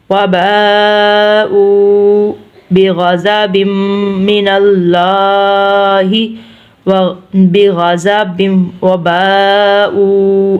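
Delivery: slow measured recitation, "وباءوا" stretched long; elongated recitation. Shown as a demonstration. Teacher reciting for learners, slow elongated recitation. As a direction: sustain "با" and "او" of "وباءوا" on a level note. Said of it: "دونوں کو لمبا کیا ہے".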